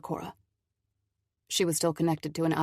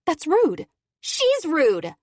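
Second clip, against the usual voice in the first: loud, high angry retort; angry snap; 11 semitones higher, 7 dB louder, much slower than usual. Acted. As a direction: snap back at your mother. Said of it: "Kendall snaps back".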